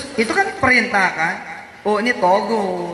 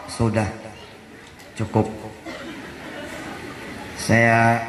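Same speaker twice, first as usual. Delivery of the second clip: calm firm refusal; 7 semitones lower, 4 dB quieter than usual. Retold or imitated. Refusing gossip, repenting.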